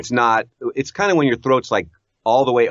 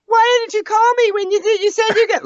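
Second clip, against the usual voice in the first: very high, hammed-up nagging wife; 3 dB louder than usual. Husband mimicking his wife's complaint, hammed-up nagging. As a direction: caricature a complaining wife in falsetto. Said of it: "what everybody does about their wife".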